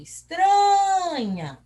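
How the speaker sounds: TRA in "Estranha" stretched high and loud; calling from afar. Shown as a demonstration. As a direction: call "Estranha" as if to someone far away, holding TRA. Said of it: "Vamos chamar longe".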